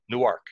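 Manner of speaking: Newark as new-ARK, nuɑɹk; Delaware Newark. Retold or imitated. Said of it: "but they say".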